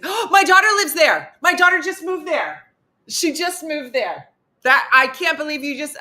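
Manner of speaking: high, loud gasping gush; gushing excitement. Retold or imitated. Missy blurting to a panelist, high and gushing. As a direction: gush like a thrilled mom.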